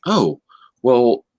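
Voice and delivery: ordinary talk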